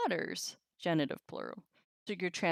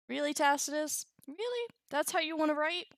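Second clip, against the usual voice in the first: higher, incredulous jabs at Tacitus; exasperated disbelief; 8 semitones higher, faster than usual. Real, not acted. Teacher scoffing at Tacitus, incredulous and higher.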